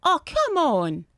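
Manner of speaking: high, drawn-out "come on" falling away; slow, frustrated wail at the game; exasperated groan. Real, not acted.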